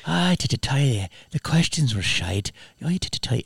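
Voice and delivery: mock Irish accent, grumbling about the questions. Telling the podcast host about an actor's complaint in the lift: mock Irish accent. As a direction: imitate an Irish actor moaning about bad press questions. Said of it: "I'm trying to do his accent".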